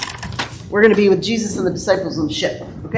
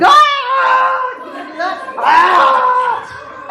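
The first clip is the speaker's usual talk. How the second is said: high, drawn-out mock scream; playful storm scream; 14 semitones higher than usual. Pastor plays at storming with children, pretend scream. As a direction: yell as if the boat is tossing. Acts out storm hitting boat.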